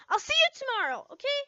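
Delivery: high bright sign-off; cheery goodbye. Acted. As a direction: end the chat brightly. Cutting conversation cheerfully.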